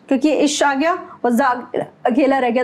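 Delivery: ordinary talk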